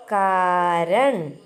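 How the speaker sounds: കാരൺ very slow, ending on a clipped ൺ; model reading. Shown as a demonstration. Teacher models കാരൺ for children, very slow. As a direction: stretch കാരൺ, closing on ൺ. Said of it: "എന്ത് വായിക്കും?".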